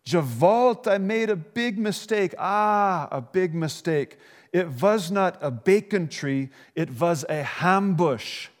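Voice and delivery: put-on high, dismayed old man with Yiddish lilt; Yiddish accent. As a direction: lament the mistake in a Yiddish accent.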